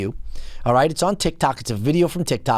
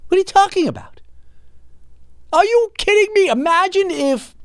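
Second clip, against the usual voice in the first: very high-pitched disbelief; incredulous outburst. Real, not acted. Host reacting to the TikTok clip, incredulous outburst. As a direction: let the voice shoot up in sheer disbelief.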